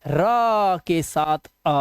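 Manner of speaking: very slowly split the sound of "र के साथ अ" into its parts; drawn-out sound breakdown. Shown as a demonstration. Teacher slowly splits the sound for students. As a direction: stretch out "र के साथ अ" very slowly so the र and the अ are heard apart. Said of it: "ये दो हैं".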